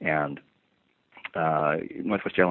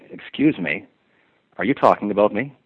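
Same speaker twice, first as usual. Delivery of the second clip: higher and louder, a sharp accusing challenge; indignant confrontation; 5 semitones higher, 7 dB louder than usual. Retold or imitated. Stewardess confronting seated passengers about their talk, indignant and louder. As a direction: demand it like you caught them gossiping.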